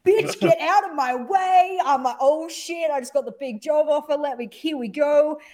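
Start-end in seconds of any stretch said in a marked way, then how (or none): 0.1-1.8 higher, slow, swaggering; cocky strut. Retold or imitated. Caster strutting backstage after a big job offer, slow and cocky. 2.2-5.4 elated. Retold or imitated. Elated after job offer.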